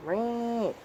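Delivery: high-pitched imitated cat meows; sing-song mock meowing at a pet. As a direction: meow up high to call the cat. Calling to the cat.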